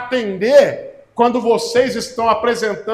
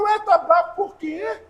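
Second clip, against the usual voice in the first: desdém fingido; 10 semitones higher, slower than usual. Retold or imitated. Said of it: "vocês falam".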